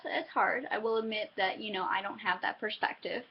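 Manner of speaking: usual speaking voice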